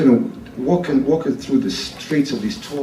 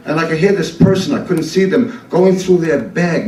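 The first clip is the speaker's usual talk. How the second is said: ordinary delivery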